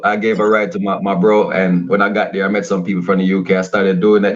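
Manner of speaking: ordinary talk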